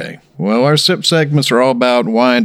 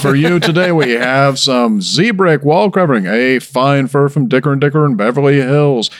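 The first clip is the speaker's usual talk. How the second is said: Don Pardo; 3 semitones higher than usual. Retold or imitated. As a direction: boom the prizes like a host. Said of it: "Now that's Don Pardo".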